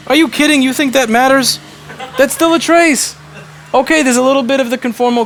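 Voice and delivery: loud, high, disbelieving rant; exasperated rant. Real, not acted. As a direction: vent at the nitpicking chat. Ranting at chat about trace.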